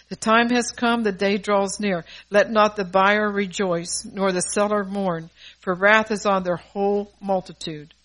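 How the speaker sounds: loud, measured scripture reading; scripture declamation. Acted. Preacher reads Ezekiel seven to the congregation, loud and declaimed. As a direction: proclaim the verses forcefully, phrase by phrase.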